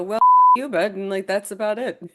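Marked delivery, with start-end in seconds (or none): 0.0-0.9 sudden high shouted brush-off; tough-guy yell. Retold or imitated. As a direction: bark it out like a rough Canadian. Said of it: "we'll just go".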